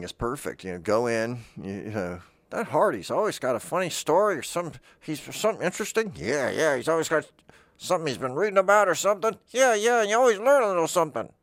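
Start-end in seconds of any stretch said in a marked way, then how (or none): 2.5-6.1 imitating friends. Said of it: "Those are my friends". 6.2-9.4 imitating friends. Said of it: "Those are my friends". 9.5-11.3 much higher, fast, excited chatter; a friend raving about him at a party; hammed-up eager agreement; mock gushing friend. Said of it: "Those are my friends".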